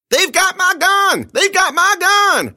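high, loud, slow panicked yell. Acted. Old man running up the drive, loud high panicked yell. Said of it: "Otis yelled".

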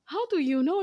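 high, wondering ask; surprised question. Retold or imitated. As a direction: ask it high, caught off guard. Mother questions daughter, surprised.

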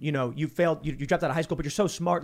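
ordinary delivery

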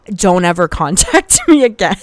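laughing through the words; breaking into laughter. Real, not acted. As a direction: quote collapsing into giggles. Breaks up laughing mid-quote.

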